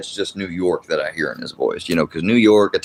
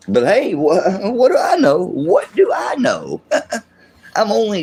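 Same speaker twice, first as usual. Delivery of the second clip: high-pitched sarcastic self-doubt; mock ignorance; 10 semitones higher than usual. Streamer scoffing at a doubted family rumor, mock ignorance. Feigns ignorance after citing research.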